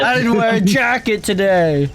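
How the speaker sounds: high-pitched wail of complaint; hammed-up high wailing; freezing victim. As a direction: cry out as if caught in the cold.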